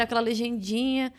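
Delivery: ordinary talk, nothing marked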